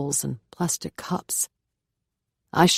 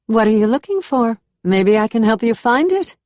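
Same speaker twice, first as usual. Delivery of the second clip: higher, louder South American lilt with a Southern drawl; South American Southern accent; 6 semitones higher, 7 dB louder than usual. A narrator's reading. Curious housemate offers the new girl help, South American Southern accent, higher and louder. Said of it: "She's as pretty as her accent, a South American cadence slowed with a Southern drawl".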